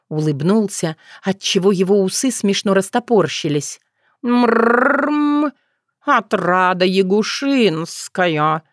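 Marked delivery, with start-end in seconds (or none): 4.2-8.6 rolled purr into a slow, stately cat voice; solemn cat announcement. A narrator's reading. Cat presenting the yaga to a client, solemn and purring. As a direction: announce her grandly, stretching the name. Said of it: "произнёс торжественно".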